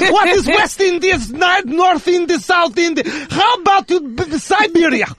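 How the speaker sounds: put-on scolding Russian father, lightly accented; Russian dad accent. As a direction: lecture him like a proud Russian dad. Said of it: "continues Russian dad bit".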